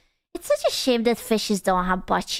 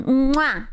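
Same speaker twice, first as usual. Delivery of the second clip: kiss sound. Pretend. Blows a kiss.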